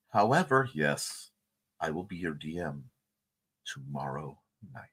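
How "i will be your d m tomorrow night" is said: soft low whisper; hushed DM menace; spooky whisper. Acted. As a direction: whisper it like a threat. DM announcement turns spooky.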